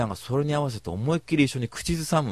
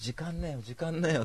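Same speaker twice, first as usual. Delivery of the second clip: put-on muttered softer, so the old man won't hear; under the breath; 4 semitones higher, 9 dB quieter than usual. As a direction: grumble it quietly to the girls, half joking. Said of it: "おじさんに聞こえないぐらいの声で".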